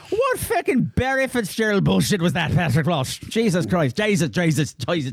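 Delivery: hammed-up Irish exasperation; bad Irish accent. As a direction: rant in a botched Irish brogue. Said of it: "I can't even do that right".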